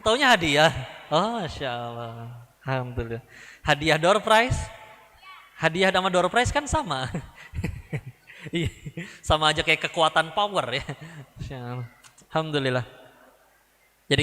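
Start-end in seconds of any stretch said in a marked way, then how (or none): none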